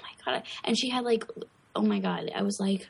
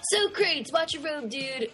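movie quote; 7 semitones higher than usual. Acted. Acting out a movie line.

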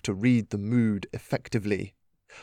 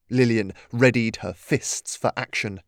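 ordinary delivery

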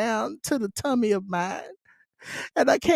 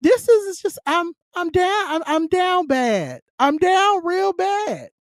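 hammed-up lament, drawn out and higher; playful fake despair, stretched out; mock sadness; 7 semitones higher than usual. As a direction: moan it like a heartbroken diva, slowly. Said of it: "the sadness in my, in my voice".

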